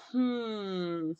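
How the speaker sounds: lightly hammed-up vanity; mock vain self-check. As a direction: tease someone checking the mirror. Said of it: "I'm not one that's really like".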